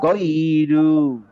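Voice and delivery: "গৈরু" very slow, glide held; light lin stretch. Shown as a demonstration. Teacher modelling for students to repeat, "গৈরু" stretched. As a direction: draw out both syllables of "গৈরু". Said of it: "এখানে একটা হালকা টান হবে".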